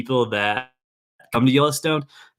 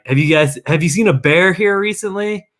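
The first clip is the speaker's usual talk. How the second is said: tourist; 8 semitones higher, 4 dB louder than usual. Retold or imitated. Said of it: "people will always stop me and be like".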